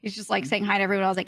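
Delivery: normal talking voice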